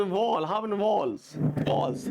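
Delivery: वॉल, वॉल्स with one rounded long vowel; odd-sounding pronunciation. Shown as a demonstration. Teacher shows what feels strange to us, वॉल as drawn "vawl". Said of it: "तो अजीब सा लगता है यार".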